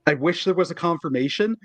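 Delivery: ordinary delivery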